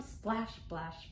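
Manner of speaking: ordinary delivery, nothing marked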